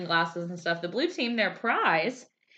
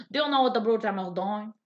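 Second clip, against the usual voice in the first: a light, quick put-on Southern drawl for the maitre d'; Larry the Cable Guy drawl; 3 semitones higher than usual. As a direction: toss it off in a fast, mock Southern drawl. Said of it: "Should I just call him Larry the Cable Guy?".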